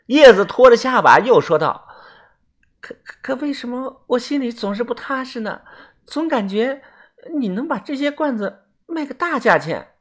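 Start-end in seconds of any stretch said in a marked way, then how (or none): 2.8-10.0 high, soft, hesitant voice; uneasy Yezi. Acted. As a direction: stammer softly with lingering doubt. (细声犹豫，心里不踏实)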